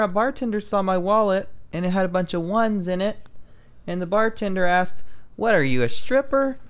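high-pitched comic setup with deliberate pauses; stand-up joke delivery. Acted. Comedian telling friends a stolen bar joke, stand-up delivery.

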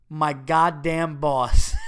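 slow, loud, laughing through it; laughing shout. Real, not acted. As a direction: burst out reading it. Reading listener's unacceptable, laughing.

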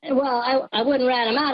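ordinary talk

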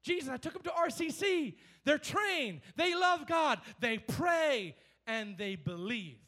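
pleading father. Retold or imitated. Father telling Jesus his son wasn't healed, insistent. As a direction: press the case like a desperate parent. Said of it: "So watch this".

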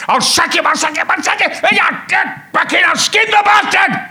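hammed-up director losing it completely, rising to a yell; enraged director swearing he'll get an actor sacked, shouting; imitating a ballistic rant. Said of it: "Herbie went ballistic".

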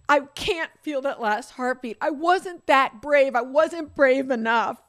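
real tears, pushing the words out slowly; crying. Real, not acted. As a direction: voice breaking into loud sobbing words. Telling of pet's death, breaking down.

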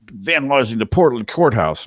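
lightly voiced grumbling man; mock the grumpy guy's complaint; grumpy passenger. Passenger grumbling about the courthouse, light mock voice. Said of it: "he's like".

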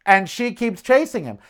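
ordinary talk, nothing marked

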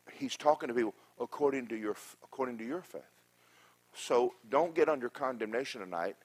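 usual speaking voice

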